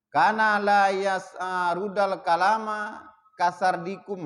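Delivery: slow, chanted Arabic reading; Arabic text recitation. Acted. Teacher recites the Arabic source to students, slow chant. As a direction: intone the Arabic line slowly.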